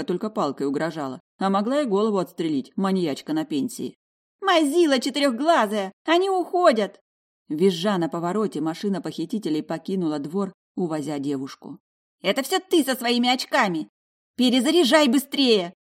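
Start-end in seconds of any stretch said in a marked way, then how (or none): 4.4-7.0 high, loud scolding granny; quarreling old woman. A narrator's reading. 12.2-15.7 high, loud scolding granny; quarreling old woman. A narrator's reading.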